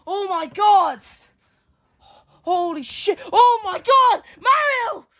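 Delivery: ordinary talk, nothing marked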